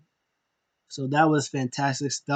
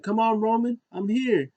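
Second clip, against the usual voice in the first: higher taunting call; mock challenge; 8 semitones higher than usual. Heel daring Roman to come, higher call. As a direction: dare him to come.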